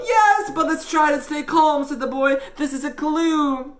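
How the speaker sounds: put-on high, loud boyish voice; panicky call for calm. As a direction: urge calm while anything but calm. Panicked boy urging calm.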